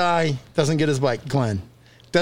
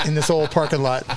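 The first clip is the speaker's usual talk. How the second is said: usual speaking voice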